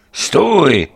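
loud, high shouted command; sudden commanding shout; firm call. A narrator's reading. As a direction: call out a firm order to halt. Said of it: "volám pevně".

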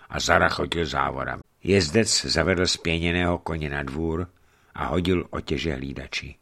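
plain reading voice

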